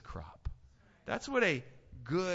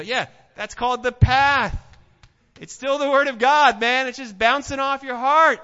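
loud, high fiery sermon delivery; preaching at full force; 9 semitones higher, 14 dB louder than usual. Acted. Preacher rouses the congregation with loud fiery preaching. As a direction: drive it home to the back row.

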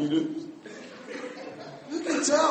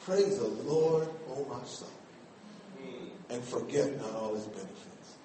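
reverent reading; 11 semitones lower, 13 dB quieter than usual. Shown as a demonstration. Preacher shows how to read the psalm, soft and reverent. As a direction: read the psalm softly like you mean it. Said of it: "And read it like you mean it".

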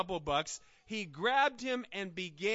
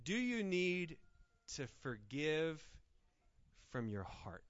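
quiet sincere appeal; 5 dB quieter than usual. Real, not acted. Preacher gently asking his church about grudges, quiet. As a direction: ask gently and let it land.

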